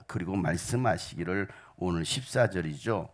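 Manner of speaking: ordinary talk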